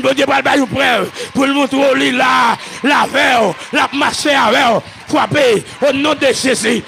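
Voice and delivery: fervent rhythmic prayer chant, driving on without a let-up; full-force preaching. Real, not acted. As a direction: pray it out in loud, pulsing bursts.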